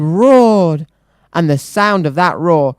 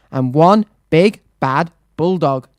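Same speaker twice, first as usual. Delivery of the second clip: ordinary delivery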